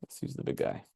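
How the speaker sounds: whispered aside to himself; whispering. Real, not acted. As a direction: breathe it out as a whisper. Repeats the choice to himself.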